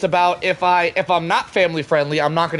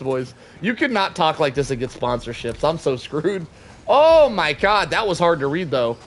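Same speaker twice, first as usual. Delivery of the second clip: usual speaking voice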